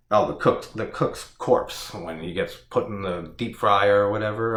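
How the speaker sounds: ordinary delivery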